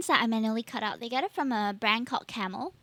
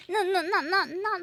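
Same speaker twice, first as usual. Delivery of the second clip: giggling, higher; laughing; 6 semitones higher than usual. Real, not acted. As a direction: laugh through the words. Laughing at her own slip.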